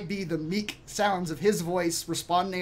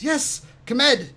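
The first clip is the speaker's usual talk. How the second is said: put-on high, louder boy's voice; high-pitched, raised young voice; boy in character; 9 semitones higher, 6 dB louder than usual. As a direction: answer the servant as an eager youth. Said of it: "Uh, Arden says".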